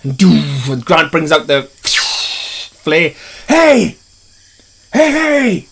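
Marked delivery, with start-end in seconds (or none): 3.5-5.7 distracting shout. Grant waving the flare at the T-Rex, higher calling shout. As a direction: yell to get the beast's attention.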